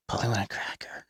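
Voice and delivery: breathy whispered quote from behind. Retold or imitated. Girl in Walmart whispering behind him, hushed whisper. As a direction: whisper it like a creepy stranger. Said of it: "walks up and whispers".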